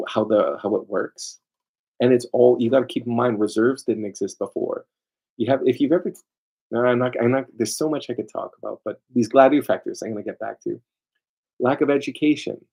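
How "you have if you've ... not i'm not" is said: usual speaking voice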